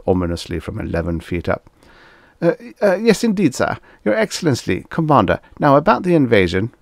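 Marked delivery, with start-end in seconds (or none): 2.4-6.7 high, stammering deference; nervous diplomat placating a superior; flustered. A narrator's reading. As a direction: fumble for the proper title, pitched up. Flustered diplomat placating a towering alien.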